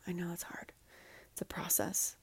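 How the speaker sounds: a tender whisper to someone who is hurting. Real, not acted. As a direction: breathe "I know that's hard" gently as a whisper. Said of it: "And I know that's hard".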